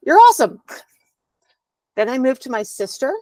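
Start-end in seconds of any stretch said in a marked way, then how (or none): 0.1-0.6 high, loud "You're awesome"; excited cheer. Retold or imitated. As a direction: cheer her on like a proud cousin. Cousins cheering her on.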